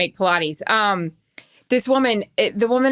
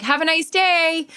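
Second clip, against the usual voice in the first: high, drawn-out singsong farewell; mock cashier send-off; 8 semitones higher, slower than usual. Cashier greeting the next customer, mock chirpy. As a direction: imitate a cashier chirping goodbye.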